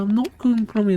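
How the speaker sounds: high, loud protest in a retold argument; mock indignant denial. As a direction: deny it in a squawk, hammed-up. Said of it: "And I'm like".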